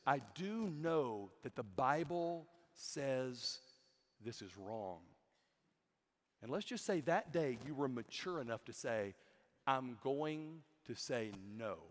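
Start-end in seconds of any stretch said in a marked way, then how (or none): 0.1-5.0 slow, low, hushed conviction; slow, softening voice of conscience; solemn inner resolve. Acted. Acted inner resolve against sin. 9.7-11.8 slow, low, soft decision; hushed, deliberate refusal; quiet resolve. Acted. Acted decision to resist sin.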